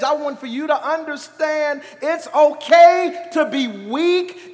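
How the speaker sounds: loud, high, impassioned pulpit shout; rousing preacher's cry with stretched key words; full-force preaching. Real, not acted. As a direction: preach it out hard, building in volume.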